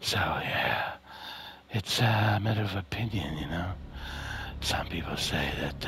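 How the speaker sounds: low, hushed action-star impression; husky near-whisper, casually noncommittal; Steven Seagal. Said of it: "if Steven Seagal was in this".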